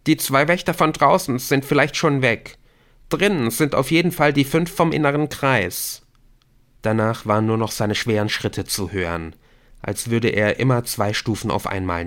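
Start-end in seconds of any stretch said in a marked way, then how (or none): 0.1-6.1 higher, projected call; calling back. A narrator's reading. Rashid tells Nick who remains, calling back. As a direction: shout information back while leaving. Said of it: "rief Rashid zurück".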